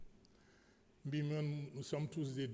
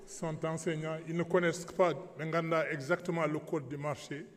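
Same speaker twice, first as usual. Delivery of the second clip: ordinary talk, nothing marked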